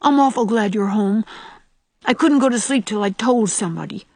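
understated acted sob of a little boy; sobbing. A narrator's reading. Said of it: "sobbed Davy".

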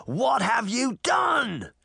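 high, slow, drawn-out bellow. A narrator's reading. Jack seeing giants descend on him, bellowing. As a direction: bellow it in horror, stretching the words. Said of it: "Jack bellowed".

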